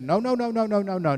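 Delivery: high, loud run of no's; emphatic acted protest; insistent denial. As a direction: pile up the denials as a mock-insistent wife. Wife insisting in acted quarrel.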